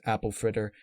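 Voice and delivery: plain reading voice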